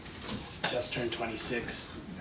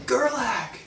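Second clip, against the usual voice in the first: high excited call across the club; calling out his name; 13 semitones higher, 4 dB louder than usual. Retold or imitated. Nightclub guy spotting a famous surfer, high excited call. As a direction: shout his name in delighted recognition. Guy recognizes him, calls out.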